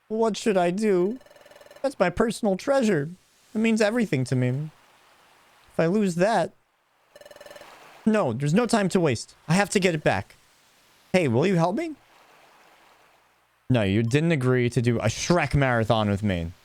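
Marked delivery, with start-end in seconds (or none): 0.1-11.9 higher, anxious plea; begging for help, fretful; worried partner voice. Acted. Elliot asks the hero to get his treasure back, higher anxious plea.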